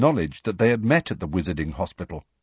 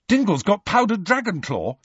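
high-pitched, keen exclamation; eager; 11 semitones higher than usual. A narrator's reading. Ron hears of a brain booster, eagerly. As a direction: seize on the news, voice jumping up. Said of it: "said Ron eagerly".

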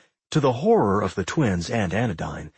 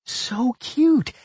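high delighted squeal of words; exclaiming; 12 semitones higher than usual. A narrator's reading. Millie gushes over the twin pups, delighted. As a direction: gush over something adorable. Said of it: "Millie exclaimed".

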